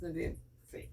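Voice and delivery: ordinary delivery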